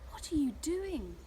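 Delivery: mildly scolding, pitch swooping up; accusing question. A narrator's reading. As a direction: ask it like a cross little cloud. Tristan confronts other clouds.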